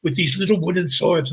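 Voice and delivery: imitated French accent, reminiscing; put-on French accent; French locals. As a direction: tell it as a Frenchman recalling boyhood. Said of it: "and they go".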